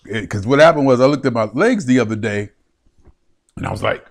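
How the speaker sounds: usual speaking voice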